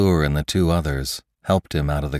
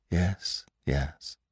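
softly, much softer, soothing a dying man; quiet murmur bent over the box; 7 dB quieter than usual. Acted. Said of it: "said McClure quietly over him".

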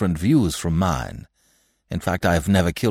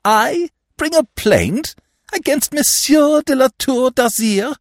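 indignant; 13 semitones higher, 6 dB louder than usual. A narrator's reading. Horrified godfather rebukes André.

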